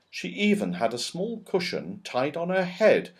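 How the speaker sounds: plain reading voice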